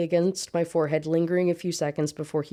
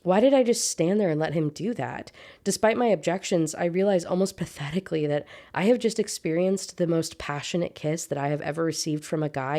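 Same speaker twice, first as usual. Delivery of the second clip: ordinary talk, nothing marked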